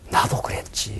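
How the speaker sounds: unvoiced whisper, like a private confession; whispered admission. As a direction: breathe it out as a quiet realization. Preacher voices his inner admission.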